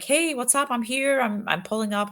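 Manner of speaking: retold breezy greeting.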